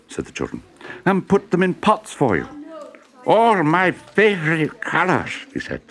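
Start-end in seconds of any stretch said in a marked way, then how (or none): 1.1-2.5 put-on high, eager children; sweet little children offering a gift; children's voice. A narrator's reading. 3.2-5.3 put-on high, slow, thrilled old professor; delighted professor. A narrator's reading.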